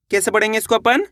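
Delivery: ordinary delivery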